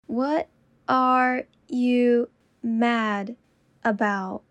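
slow, each word apart. Shown as a demonstration. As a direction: say it slowly, word by word. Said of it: "repetition drill, slowed take".

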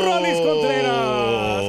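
low, slow announcer intro. Acted. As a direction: boom out the name slowly. Introducing guest announcer-style. (voz de locutor)

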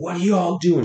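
put-on high, loud yell at the kids; retold yelling. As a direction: shout across the store at the kids. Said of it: "Screaming at the top of my lungs".

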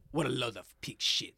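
mock-French character voice; French accent, a bit mobster-like. Shown as a demonstration. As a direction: repeat the line in a put-on French accent. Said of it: "that's the way he says it".

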